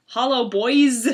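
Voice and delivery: loud, drawn-out creature greeting; crooked alien voice. A narrator's reading. Sammy the creature greets John and Sherlock, crooked alien voice. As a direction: stretch it out like a crooked little alien, not whispered. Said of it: "whispered in a crooked alien voice".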